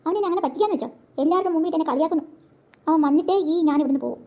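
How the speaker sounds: ordinary delivery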